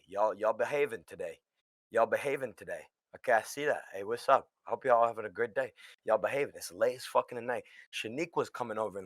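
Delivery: ordinary talk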